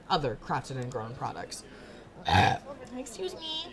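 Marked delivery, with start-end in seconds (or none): 1.7-2.9 harsh throat-clearing croak; loud, low "ahem"; attention-getting cough. Acted. 3.0-3.7 high, thin "excuse me"; pitched far up, polite interruption; squeaky little interjection; attention-seeking character voice. Acted.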